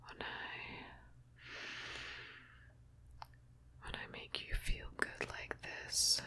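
very soft close whisper. Acted. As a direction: whisper it right into the ear. Intimate ASMR roleplay whisper.

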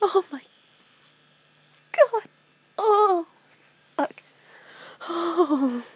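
high, loud cries between pauses; broken exclamations, out of breath; breathless, overwhelmed exclamation. Real, not acted. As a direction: blurt it out, voice pitched high. Overwhelmed reaction while handling the device.

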